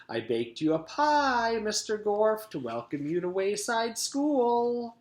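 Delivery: high-pitched, sloshy cook's voice; Miss Mush. A narrator's reading. Miss Mush welcomes the new teacher, high and sloshy. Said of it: "like two boots sloshing through mud".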